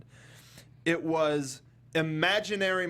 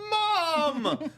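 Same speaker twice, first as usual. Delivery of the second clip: high, wailing cry of Mom; childish wail for mom; whiny cry for mom; 11 semitones higher, 3 dB louder than usual. Retold or imitated. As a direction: wail for mom like a lost kid. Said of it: "He's all about".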